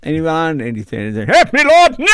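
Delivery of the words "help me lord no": high, frantic comic shout; Robin Williams yelling. Retold or imitated. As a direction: yell it wildly, way up high. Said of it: "He's yelling the jokes out".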